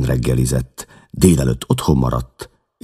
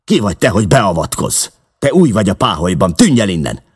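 higher, louder, fast furious retort; angry gang boss; 6 semitones higher, 4 dB louder, faster than usual. A narrator's reading. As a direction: spit it at the intruder. Baldwin snarls at newcomer.

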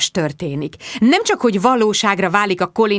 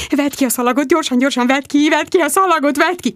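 high-pitched, breakneck repeated orders; frantic urging; 6 semitones higher than usual. A narrator's reading. Friend demands the tape be taken out, frantic. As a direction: urge them on in a panic.

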